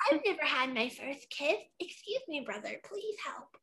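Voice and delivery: high, fading, ghostly plea; haunting voice. Acted. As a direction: breathe it out like a ghost, high and softening. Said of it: "haunting voice".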